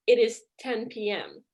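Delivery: ordinary talk, nothing marked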